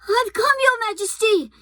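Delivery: put-on high, eager boy. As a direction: rush in, keen to please.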